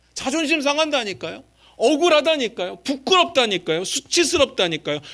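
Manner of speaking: high, loud wail of injustice; acted aggrieved outburst. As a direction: protest as the wronged one, stretching each plea. Acting out wounded, wronged believers. (억울해서 높고 크게 하소연하듯)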